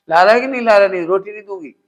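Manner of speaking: loud, angry demand from a mother; mother scolding. Retold or imitated. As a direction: shout at the child to bring the water. (माँ बच्चे पर चिल्लाकर डाँटती है)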